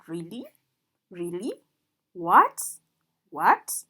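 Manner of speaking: second-tone question lilt; questioning rise. Shown as a demonstration. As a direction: ask "Really? What?" with a strong rising tune. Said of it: "It sounds like you're asking a question".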